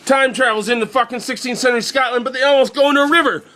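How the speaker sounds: high, breathless-paced movie recap; excited, high-pitched storytelling; frantic action retelling. Retold or imitated.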